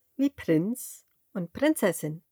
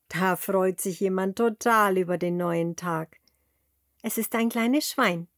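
usual speaking voice

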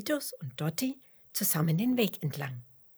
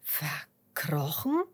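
slow, drawn-out puzzled echo; erstaunte Frage; 2 semitones lower, much slower than usual. A narrator's reading. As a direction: repeat it slowly in mild astonishment. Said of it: "fragt Fitus erstaunt". (langsam und erstaunt nachfragend)

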